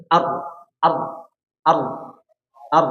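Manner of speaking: ar with the r pressed hard, no airflow; ar held tight and stopped, the wrong way; wrong heavy r. Shown as a demonstration. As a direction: clamp the tongue on each ar so the sound stops. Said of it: "Maka seperti ini salah".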